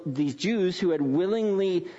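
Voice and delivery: normal talking voice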